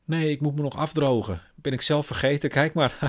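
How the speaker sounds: high, bright, forced cheer; fake cheerful mother. Mother brushes off her daughter with high forced brightness. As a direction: keep up a brave front.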